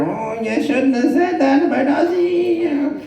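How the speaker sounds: high, slow, drawn-out needling voice; nagging jockey in the ear. Retold or imitated. Jockey in your ear after the row, high drawn-out reproach. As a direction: needle them like a guilty conscience, high and slow. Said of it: "The jockey is whispering in your ear".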